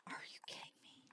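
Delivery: hissed under the breath, disbelieving; whispered are you kidding me; exasperated whisper. Real, not acted. Dying to a skeever in game.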